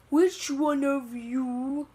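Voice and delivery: slow, put-on monster parent voice; lightly voiced looming giant, drawn out; giant dad's demand. Giant octopus dad confronts the group, slow mock menace.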